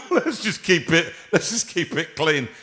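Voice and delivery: slow words through a laugh. Real, not acted. Host laughing, telling caller to keep it clean. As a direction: steer it back laughing.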